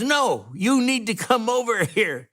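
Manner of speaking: father-in-law insisting he come over, mock yell; insistent call. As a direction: call him over firmly, retold. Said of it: "He says".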